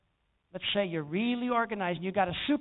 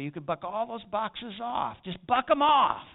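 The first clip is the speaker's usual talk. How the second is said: higher, louder, slow tempting push that swells to a shout; a hint of a devil urging him to quit; devil's tempting voice; 5 semitones higher, 4 dB louder than usual. Tempter voice urging the pastor to quit, slow and rising to a shout. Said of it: "the devil spoke to me then, says".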